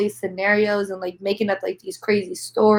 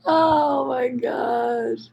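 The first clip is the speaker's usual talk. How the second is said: tearful sigh; 3 semitones higher, 4 dB quieter, much slower than usual. Real, not acted. Crying on stream.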